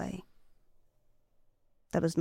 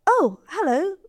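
surprised greeting; 10 semitones higher, 4 dB louder than usual. Acted. Gentle Breeze greets Scarface surprised.

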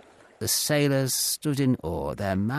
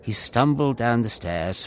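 usual speaking voice

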